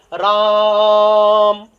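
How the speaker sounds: राम stretched very long and loud on the आ; prolonged Raam. Shown as a demonstration. Teacher demonstrating राम drawn out as a pluta vowel. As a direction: hold the आ in राम for three beats. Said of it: "इसका उच्चारण कैसे करेंगे".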